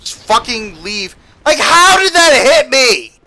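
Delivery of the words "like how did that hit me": high, loud, slow outraged rant; raging gamer. Real, not acted. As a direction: rage at the broken game. Raging at hitbox.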